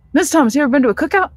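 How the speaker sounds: put-on high, rapid, eager teen voice; quick excited student question; fast-talking student. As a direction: rattle it off like a fast-talking kid. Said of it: "Eddie talks really fast".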